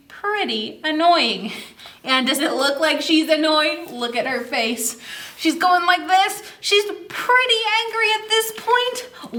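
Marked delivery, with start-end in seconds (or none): none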